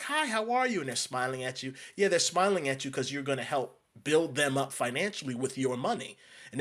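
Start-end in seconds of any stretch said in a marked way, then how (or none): 0.0-0.8 sugary high-pitched mock shopkeeper hello; imitating a chirpy shop owner; fake friendly greeting. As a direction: parody an overly cheerful store owner.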